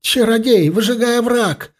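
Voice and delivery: high, strained shout; frantic cry. A narrator's reading. Elf begging the sorcerer to burn the ravine, frantic cry. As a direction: cry out in a voice not your own. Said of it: "не своим голосом выкрикнул эльф".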